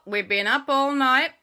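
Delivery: slow, put-on tough biker drawl. The Hares of Havoc answering the boys, slow biker voice. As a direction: play a laid-back biker gang.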